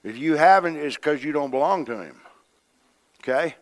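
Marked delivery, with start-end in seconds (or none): none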